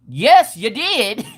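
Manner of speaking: slow, high, insistent comeback; comic yelled insistence, drawn out; retold yelling. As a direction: stretch out the mock-outraged contradiction. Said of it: "And I'm yelling".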